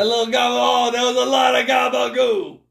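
hammed-up New York Italian voice; mock Italian-American wiseguy; Italian-American accent. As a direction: exclaim it like a cartoon Italian New Yorker. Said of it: "you were talking in an Italian accent".

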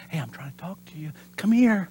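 soft, drawing voice. Retold or imitated. God drawing us closer, soft coaxing. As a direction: pull them in quietly. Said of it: "still small voice".

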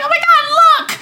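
put-on squeaky high child exclaiming; shrill high little-kid gasp. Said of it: "little children voices".

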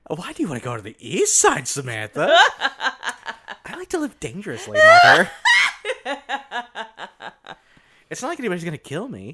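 put-on bratty kid voice. Mom-child skit, Samantha.

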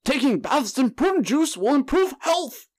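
very high, slow, drawn-out politician voice; hammed-up, off-key campaign proclamation; Bernie Sanders impression. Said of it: "I'd take Bernie's old ass".

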